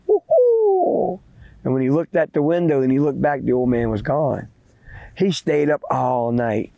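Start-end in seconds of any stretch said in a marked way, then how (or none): 0.1-1.2 high falsetto owl hoot; imitated owl call. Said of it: "the owl went".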